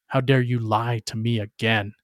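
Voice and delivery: ordinary delivery, nothing marked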